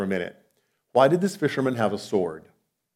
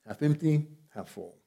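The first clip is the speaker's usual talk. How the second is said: soft contrast; 2 semitones higher, 7 dB quieter than usual. Shown as a demonstration. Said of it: "repeated contrast, softer".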